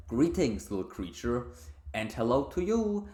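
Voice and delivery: tiny creature piping up; chirping creature. Acted. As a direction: chirp it out in a lifted, lilting pitch.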